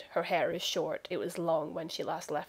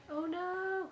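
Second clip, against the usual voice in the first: much higher, a squeaky cry of dismay; mock dismay; 11 semitones higher than usual. Real, not acted. GM reacting to a player's burnt chicken, high mock dismay. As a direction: play up the shock for a laugh.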